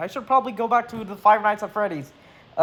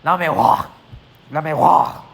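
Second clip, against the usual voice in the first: French accent; 4 semitones lower than usual. Shown as a demonstration. Streamer corrects himself on the singer's name, French accent. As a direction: say Lamiroir in a French accent, ending on "rwahr". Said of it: "You gotta say it with a French accent".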